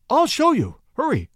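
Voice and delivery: high-pitched, urgent pleading; acted frantic high voice; frantic urging. A narrator's reading. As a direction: urge him on in a panic. Frantic wife hurries husband into attic.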